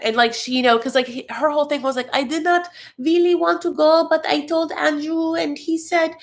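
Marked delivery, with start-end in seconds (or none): 2.1-6.1 put-on Amira's accent. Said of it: "I don't know what this accent is".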